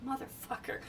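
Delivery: a soft, muttered swear under the breath; muttered curse. Retold or imitated. About the mage raising the key, quietly muttered curse. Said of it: "I'm like".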